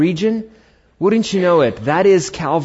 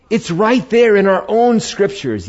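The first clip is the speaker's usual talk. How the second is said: high-pitched cry of discovery; astonished recognition; 8 semitones higher, 4 dB louder than usual. Acted. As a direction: point it out as if just discovering it.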